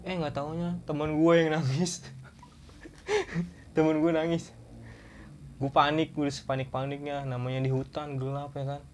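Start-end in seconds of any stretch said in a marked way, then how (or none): 2.1-3.7 high breathy laugh. Real, not acted. Laughing at friend crying.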